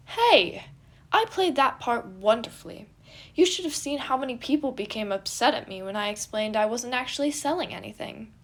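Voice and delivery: loud, high offended protest; offended prince. A narrator's reading. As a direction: defend himself, affronted.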